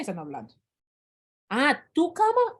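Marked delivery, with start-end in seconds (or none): 1.5-2.6 high, drawn-out surprised "ah, tu cama"; mock surprise. The teacher playing a confused listener, suddenly getting it. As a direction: play the listener who finally gets it.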